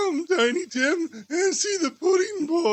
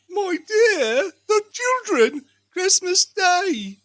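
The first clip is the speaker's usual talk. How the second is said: pleading; 6 semitones higher, 5 dB louder, slower than usual. Acted. Wife pleads with husband.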